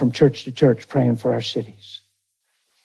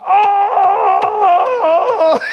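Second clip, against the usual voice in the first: a high, loud burst of delighted surprise; overjoyed cry of surprise; joyful surprise; 4 dB louder than usual. Real, not acted. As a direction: let the good news hit you all at once. Overjoyed at friend's conversion story.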